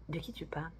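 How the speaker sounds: hushed whisper; whispered question. Retold or imitated. Manager whispering back about the coworker. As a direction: ask it secretly, not to be overheard. Manager asks quietly who.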